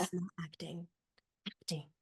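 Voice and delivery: whispered, breathy hush; mock whispered acting. Acting teacher whispering to students what acting is. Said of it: "the teacher teaching the students how to act".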